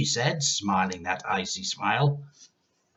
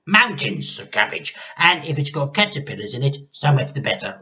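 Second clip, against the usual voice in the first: put-on loud, higher bossy old woman; an old woman barking orders; grandma ordering the boy about; 5 semitones higher, 8 dB louder than usual. A narrator's reading.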